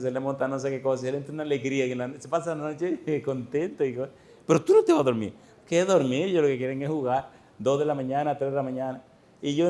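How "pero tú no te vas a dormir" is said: put-on high, loud protest at the baby; exasperated father. Tired father pleading with his baby at night, high and loud.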